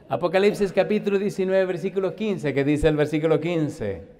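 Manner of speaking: loud call and response with the church; fervent preaching. Real, not acted. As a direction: make them answer back. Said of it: "call-and-response Scripture reading".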